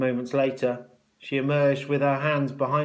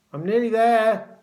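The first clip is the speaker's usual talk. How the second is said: high, loud call from behind curtains; 10 semitones higher, 8 dB louder than usual. A narrator's reading. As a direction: call back to the waiting matron. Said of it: "called out Tom".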